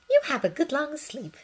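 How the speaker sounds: tuck him in with a gentle voice; gentle mother soothing a hurt boy. Acted. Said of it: "she said gently".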